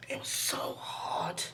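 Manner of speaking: very slow, whispered and choked; grieving whisper. Real, not acted. A man remembers losing the girl, whispering slowly in grief. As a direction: barely get the words out through grief. Grief over the girl's death.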